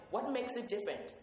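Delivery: ordinary delivery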